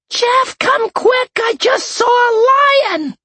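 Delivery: high, slow shrieking woman's voice; alarmed exclaiming. A narrator's reading. Woman calling Jeff to come see a lion, high alarmed shriek. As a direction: cry out in alarm, high and drawn out. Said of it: "he heard someone exclaim".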